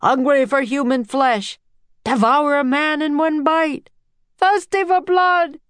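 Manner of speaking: menacing shouts climbing in pitch; shouting. A narrator's reading. Frightened crowd accusing the octopus, shouting. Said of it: "everyone kept shouting".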